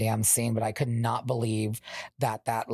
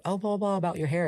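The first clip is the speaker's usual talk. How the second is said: sweet mother voice; 8 semitones higher, faster than usual. Retold or imitated. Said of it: "asking the kid".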